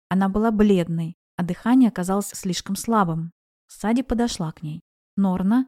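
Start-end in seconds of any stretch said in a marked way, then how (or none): none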